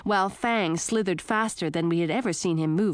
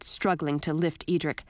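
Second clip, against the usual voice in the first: usual speaking voice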